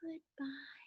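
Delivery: whispered goodbye, barely audible; teacher whispers goodbye as a soft-voice demo. Shown as a demonstration. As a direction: breathe it as softly as you can. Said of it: "I'm gonna say it very softly".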